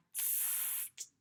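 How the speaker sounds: soft voiceless cat hiss; annoyed cat hissing. Acted. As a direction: hiss through the teeth like a cross cat. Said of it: "hissed Smiler".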